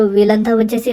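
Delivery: ordinary talk, nothing marked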